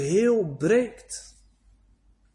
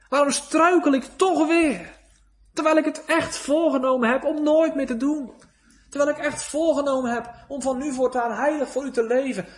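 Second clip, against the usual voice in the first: anguished plea to God; 7 semitones higher than usual. Acted. Preacher voicing a sinner begging God, raised anguished plea. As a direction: plead with God, voice climbing in despair. Believer's desperate prayer to God.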